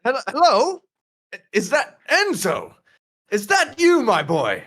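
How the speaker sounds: high, swooping voice of a surprised grandfather; delighted grandfather. Acted. Grandfather spots Enzo through the smoke, delighted and high-pitched. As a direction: call out to a grandson you didn't expect. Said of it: "your grandfather".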